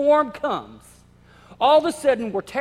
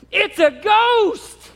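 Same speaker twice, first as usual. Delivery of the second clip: high, loud, slow shriek of terror; terrified scream; 11 semitones higher, 8 dB louder, much slower than usual. Retold or imitated. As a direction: scream at the thing on the water. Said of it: "their worst fear".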